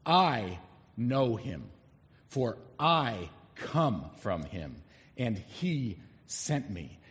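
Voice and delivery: I stressed, very slow and deliberate; emphatic I. Shown as a demonstration. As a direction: lean on every I, slowly. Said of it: "It's emphatic".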